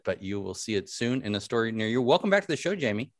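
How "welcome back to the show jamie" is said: usual speaking voice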